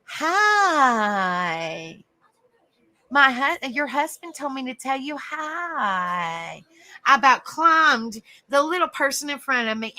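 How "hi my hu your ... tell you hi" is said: long drawn-out "hi", sliding down; creepy clown greeting. Retold or imitated. Clown creeping up on a scared wife, drawn-out teasing hello. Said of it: "and he was like".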